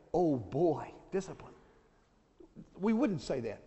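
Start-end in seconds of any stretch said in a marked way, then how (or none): 0.1-1.5 put-on dry, low sarcastic "Oh boy, discipline"; mock sarcastic "Oh boy". Preacher mocking how we greet discipline, dry sarcasm. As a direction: pull a face and sound unimpressed. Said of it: "don't say".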